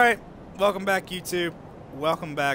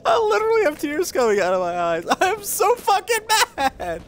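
very high, tearful wail; crying with rage. Real, not acted. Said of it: "crying right now".

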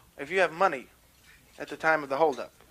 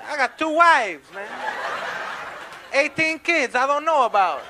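slow, high, loud brag; pitched-up swaggering street guy; boasting catcaller; 6 semitones higher, 4 dB louder, slower than usual. Acted. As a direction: boast it out slowly to impress.

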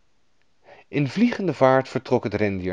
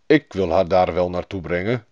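low animal-character voice; helpful reindeer in a lowered voice; talking reindeer; 4 semitones lower than usual. A narrator's reading. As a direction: offer help as a storybook reindeer, pitched down. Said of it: "En het rendier zei".

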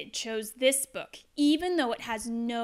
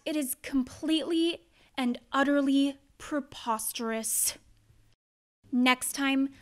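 usual speaking voice

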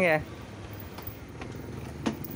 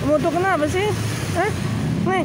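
much higher sing-song question to the cat; high pet voice; 11 semitones higher, faster than usual. Real, not acted. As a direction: coo it up high at a sulky cat. Coaxing the cat.

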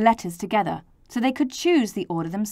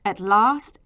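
ordinary delivery, nothing marked

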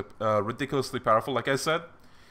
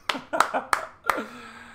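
triumphant laugh; 10 semitones higher than usual. Real, not acted. After victory shout.

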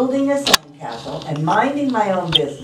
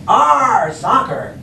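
loud Arr, dropping on soccer; growl-free pirate cheer, moderately loud; pirate yell; 4 semitones higher, 4 dB louder, slower than usual. Acted. Braidbeard kicks the ball, short pirate yell. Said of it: "kicked the ball and yelled".

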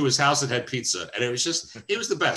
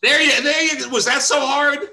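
teasing praise; 6 dB louder than usual. Real, not acted. Praising Sonny's pronunciation.

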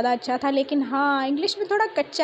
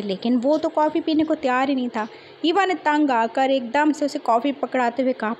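ordinary delivery

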